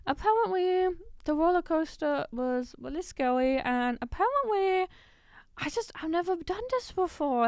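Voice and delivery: high, loud nervous guest voice. Retold or imitated. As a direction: ham up a nervous newcomer. Continues imitation.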